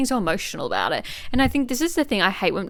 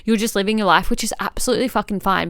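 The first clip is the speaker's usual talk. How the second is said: ordinary talk, nothing marked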